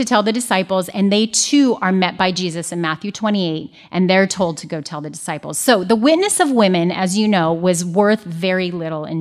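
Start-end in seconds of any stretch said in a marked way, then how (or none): none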